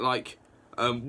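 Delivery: ordinary talk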